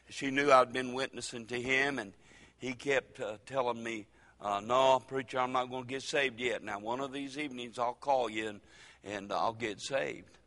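usual speaking voice

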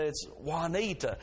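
Juanita said wa-nita, J silent as H; Spanish J. Shown as a demonstration. The kind man giving the right Spanish reading. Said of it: "those J's have an H sound".

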